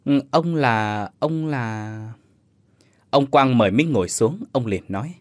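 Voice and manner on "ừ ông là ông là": slow, halting, trailing off; stammering. Acted. Minh faltering before an old stranger, slow hesitant stammer. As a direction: fumble for the words. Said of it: "ấp úng đáp".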